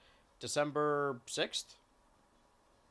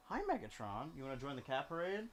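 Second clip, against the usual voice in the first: 1 soft pet talk; 3 semitones higher, 9 dB quieter than usual. Real, not acted. Cooing to cat.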